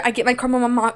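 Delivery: normal talking voice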